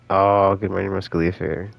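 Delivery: ordinary talk, nothing marked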